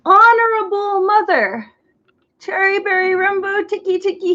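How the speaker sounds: high, gasping out the words; nearly out of breath. Acted. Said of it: "he gasped".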